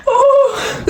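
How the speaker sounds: helpless high-pitched laugh; high squealing laugh. Real, not acted. Laughing fit.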